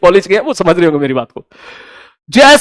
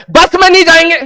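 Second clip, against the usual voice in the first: pitched way up, refusing the bus; a high, pushy no to the bus; high insistent demand; 9 semitones higher than usual. Retold or imitated. Demanding a car, not bus.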